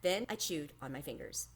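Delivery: usual speaking voice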